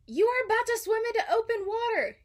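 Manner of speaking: high, loud accusing dad. A narrator's reading. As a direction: scold him, cutting him off. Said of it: "he accused".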